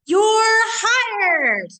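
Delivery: high, loud cheering announcement; excited shout. Acted. Teacher bursting out with the good news to the class, excited. Reading story, excited exclamation.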